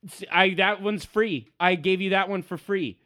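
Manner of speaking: high, loud bluster; blustering halfling. Acted. Halfling after a crossbow shot at his feet, loud bluster.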